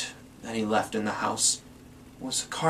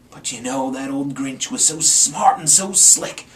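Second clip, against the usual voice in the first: usual speaking voice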